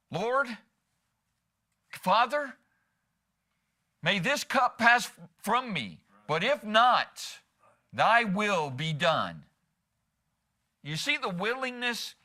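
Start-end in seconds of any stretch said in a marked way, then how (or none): 0.1-9.4 slow, high-pitched pleading that settles at the end; measured, beseeching prayer; earnest prayer. Acted. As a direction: plead slowly, pausing between phrases, then yield. Voices Jesus praying before the cross.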